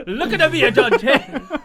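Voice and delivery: loud laughing Italian-accent mimic. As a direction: shout it while cracking up. Imitating Santino Marella, laughing.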